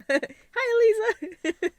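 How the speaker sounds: high, loud mock-delighted hello; excited greeting. Vlogger hails brother's girlfriend who rang from Italy, mock-excited.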